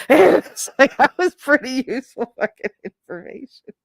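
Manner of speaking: high, laughing retelling. Real, not acted. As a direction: repeat it while cracking up. Laughing while repeating friend's retort.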